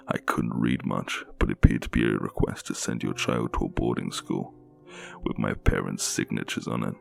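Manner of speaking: ordinary delivery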